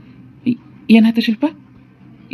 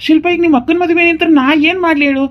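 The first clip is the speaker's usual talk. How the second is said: raised, higher-pitched voice; exasperated protest; 7 semitones higher, 4 dB louder than usual. Acted. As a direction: protest that you are not to blame. Husband protests during quarrel.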